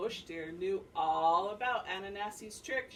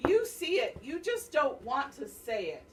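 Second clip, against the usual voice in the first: higher, louder accusing snap; furious Ananasi; 5 semitones higher, 7 dB louder than usual. Acted. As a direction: stamp it out in frustration. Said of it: "Ananasi stamped his legs".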